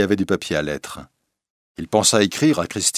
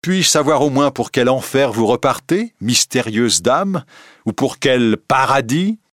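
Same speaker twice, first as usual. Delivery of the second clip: loud, higher mocking question, slowing at the end; ironic; 6 semitones higher, 5 dB louder than usual. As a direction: sneer the question with bitter irony. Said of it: "dit Raoul d'un ton ironique".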